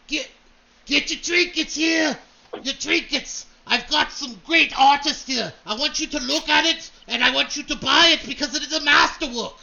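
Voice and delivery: big booming voice. Acted. Dwarf hawking trinkets to the crowd, big booming bellow. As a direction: boom it out like a market crier. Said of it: "this big booming voice".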